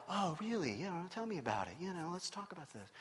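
soft, hushed counselling voice; pastor's polite front. Acted. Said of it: "the pastor's like".

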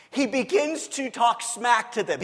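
ordinary delivery

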